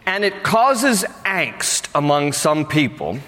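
ordinary talk

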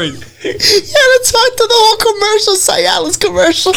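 high-pitched laughing while talking. Real, not acted. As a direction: crack up mid-sentence. Laughing at a silly commercial.

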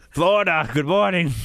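slow Brooklyn clown voice, lightly done; a hint of a Brooklyn Pennywise; Brooklyn accent. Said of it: "where he had a Brooklyn accent".

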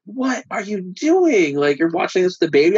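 a startled wife catching him in the act; imitating the wife's shocked disbelief; alarmed wife. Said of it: "she was like".

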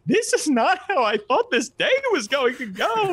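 very high-pitched comic blubbering; hammed-up crying. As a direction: wail through exaggerated mock sobs. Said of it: "is crying".

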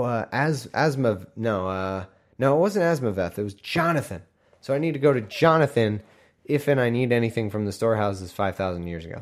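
normal talking voice

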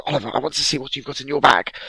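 high, fast, firm; mother won't take no; demanding mother. Retold or imitated.

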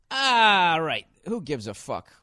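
ordinary delivery

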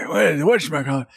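put-on creaky old man asking; crotchety old timer voice; old guy voice. Shown as a demonstration. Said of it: "you gotta really do the old guy voice".

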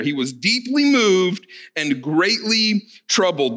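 slow, weighty stress on "deeply moved" and "greatly troubled"; emphasized phrases. Shown as a demonstration. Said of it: "I wanna emphasize those two phrases".

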